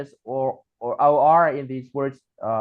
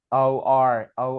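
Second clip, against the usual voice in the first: normal talking voice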